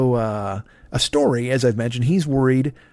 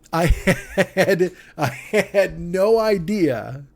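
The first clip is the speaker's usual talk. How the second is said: slow, laughing, higher-pitched incredulity; laughing disbelief; 5 semitones higher, much slower than usual. Real, not acted. Podcaster admitting he never knew the actor was English, laughing in disbelief. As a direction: laugh through it, stretching the words out.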